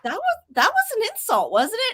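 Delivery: high, falsetto mock outrage; mock offended. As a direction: act shocked at the insult, voice way up. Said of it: "I was like".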